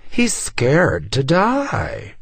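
high, very drawn-out mockery; hissed taunt. A narrator's reading. Fashionista mocking the frightened captive, high and drawn out. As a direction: stretch it out high and scornful. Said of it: "one of the fashionistas hissed".

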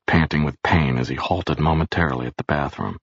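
ordinary delivery, nothing marked

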